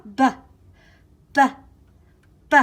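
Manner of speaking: short, popped "B" sounds with pauses. Shown as a demonstration. Teacher showing kids Bubba Bear's "b" sound, short and popped. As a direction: pop out "B", "B", "B" from the lips. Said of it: "Bubba Bear says B".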